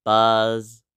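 an odd, droning buzz; lightly voiced fly; buzzy fly voice. A narrator's reading. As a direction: sound like a little fly. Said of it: "And Fly Guy said".

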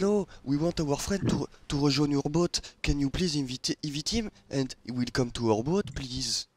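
put-on polite French-accented English. To a rival pirate crew, a light French accent in English. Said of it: "je prépare mon meilleur accent".

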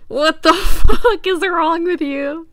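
loud yell breaking into laughter; laughing shout. Real, not acted. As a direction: shout it while cracking up. Repeating the shirt text.

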